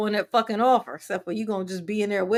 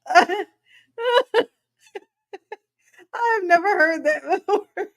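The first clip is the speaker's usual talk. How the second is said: higher, laughing out the words; 9 semitones higher, 4 dB louder than usual. Real, not acted. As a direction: barely get it out for laughing. Laughing hard.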